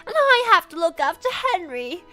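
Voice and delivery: very high, loud British cartoon girl; pissed-off Angelina. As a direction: plead in a hammed-up British tantrum. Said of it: "fucking pissed".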